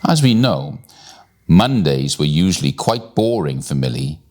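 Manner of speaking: usual speaking voice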